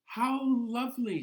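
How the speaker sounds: high, bright witch delight; little witch voice. Acted. Witch welcoming the ghosts in the story, high delighted voice. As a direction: squeal it like a pleased little witch. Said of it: "she said".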